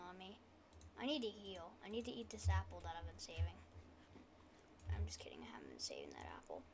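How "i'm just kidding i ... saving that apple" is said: fast, unvoiced whisper; quiet whisper. Real, not acted. Joking to viewers about the apple in a fast whisper. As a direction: whisper the joke quickly. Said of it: "I'm trying to talk quietly".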